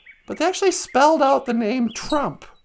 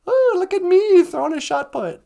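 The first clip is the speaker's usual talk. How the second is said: higher, louder mock boast; mocking an athlete's shot put; mock bragging; 5 semitones higher, 4 dB louder than usual. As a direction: show off sarcastically.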